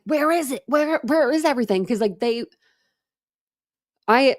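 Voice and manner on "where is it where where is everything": frantic searching. Retold or imitated. Retold frantic search for trash.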